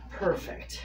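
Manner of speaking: ordinary delivery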